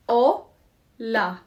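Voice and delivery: "Hola" broken into two beats, O, pause, la; clapped syllables. Shown as a demonstration. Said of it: "Let's clap it".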